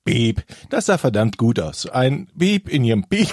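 normal talking voice

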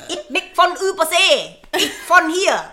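loud, high put-on voice; hammed-up comic indignation; mock protest. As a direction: declare origins in a silly loud voice. Mocks being called an immigrant.

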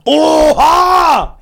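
high, loud oha; loud surprised gasp. Real, not acted. Friend reacting to the knocking story, loud surprise. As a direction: exclaim in shock.

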